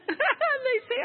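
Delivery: high-pitched laugh through the words. Real, not acted. Preacher amused at Canadians always apologising, laughing. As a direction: break up laughing while speaking.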